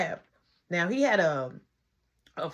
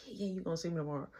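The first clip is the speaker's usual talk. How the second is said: fast soft rush; 3 semitones lower, 11 dB quieter, much faster than usual. Retold or imitated. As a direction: hurry it out. Rushing off phone.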